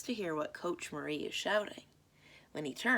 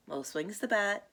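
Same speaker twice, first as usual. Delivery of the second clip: ordinary delivery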